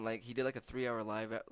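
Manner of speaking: usual speaking voice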